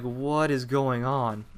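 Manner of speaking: slow, stretched-out bewilderment; baffled disbelief. Retold or imitated. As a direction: draw the question out, utterly confused. Bewildered reaction in the hospital.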